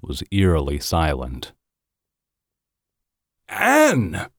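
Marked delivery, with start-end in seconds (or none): 3.5-4.3 alarmed gasping cry, pitched much higher; gasped name. Acted. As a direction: gasp her name in sudden alarm. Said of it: "gasped Boswell".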